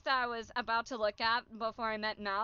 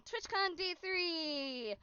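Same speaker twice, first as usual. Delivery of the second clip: slow announcer; 5 semitones higher, 5 dB quieter, much slower than usual. Real, not acted. Announcing the day.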